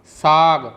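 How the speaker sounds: "साग" loud, with a long aa and falling end; model pronunciation. Shown as a demonstration. Teacher reading the finished word to learners, "साग" loud and drawn out. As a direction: sound out "साग" firmly, stretching the vowel. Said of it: "उच्चारण ऐसे है".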